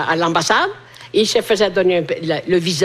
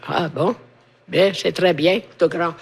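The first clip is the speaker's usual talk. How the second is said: ordinary delivery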